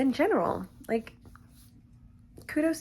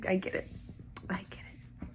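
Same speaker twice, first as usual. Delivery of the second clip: very soft, whispered aside; embarrassed murmur to yourself; sheepish whisper; 3 semitones lower, 13 dB quieter than usual. Real, not acted. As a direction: trail off into a whisper. Sheepish aside about being crazy.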